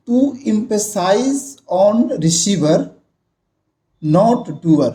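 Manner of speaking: very slow, each word spaced out; slow classroom dictation. Shown as a demonstration. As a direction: dictate the rule slowly to the class. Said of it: "teacher reads rule aloud".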